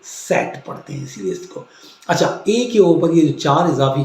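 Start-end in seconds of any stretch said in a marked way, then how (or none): 0.0-1.6 सेट said softly, nearly whispered; सेट with a ts sound; quiet aside on सेट; German z. Shown as a demonstration. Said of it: "जेड कभी भी शुरू के किसी भी word में आएगा तो वो एस की आवाज़".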